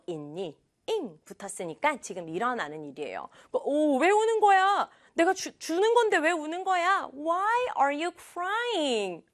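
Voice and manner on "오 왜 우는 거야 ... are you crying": high, alarmed 왜 울어 then slow Why are you crying; a boy caught off guard by tears; 놀란 오빠 목소리; startled big brother. Acted. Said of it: "놀라가지고".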